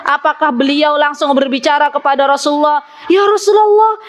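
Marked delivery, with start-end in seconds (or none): 3.1-4.0 mock panicked wife. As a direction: play a wife who panics at once. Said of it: "Langsung panik".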